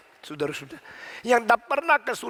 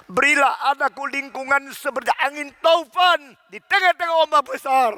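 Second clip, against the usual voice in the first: high, ringing preacher's plea; impassioned quoted prayer, raised and projected; fervent prayer climax; 7 semitones higher, 5 dB louder than usual. Retold or imitated. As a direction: cry out the prayer at full force.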